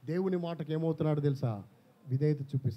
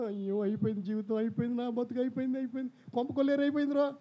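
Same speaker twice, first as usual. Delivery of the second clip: higher, hammed-up lament that keeps climbing; mock wailing; 8 semitones higher, 3 dB louder than usual. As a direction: act out a panicked wail over a ruined life. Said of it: "అని ఏడుస్తావు". (ఏడుస్తూ నటించిన విలాపం)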